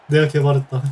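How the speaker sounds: normal talking voice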